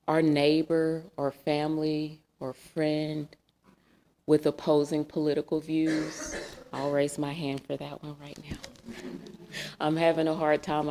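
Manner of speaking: ordinary talk, nothing marked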